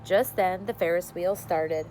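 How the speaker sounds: ordinary delivery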